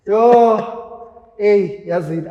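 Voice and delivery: a loud, high burst of emotion; overwhelmed outburst. Real, not acted. Hearing her best friend's voice note, bursting out loudly. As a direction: cry out, moved and overwhelmed.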